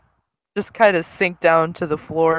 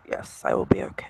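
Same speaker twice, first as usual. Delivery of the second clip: quiet self-reassurance; 7 dB quieter, faster than usual. Acted. Character reassuring herself quietly.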